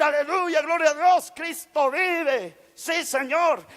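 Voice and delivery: high-pitched, acted hallelujah cry; mock-triumphant acclamation, stretched out; exultant praise. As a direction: exclaim it like a rousing church response. Acts out hoped-for praise shouts.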